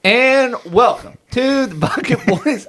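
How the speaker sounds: announcer-style intro; news anchor voice. Shown as a demonstration. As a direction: read it like a TV anchor. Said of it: "too news anchory?".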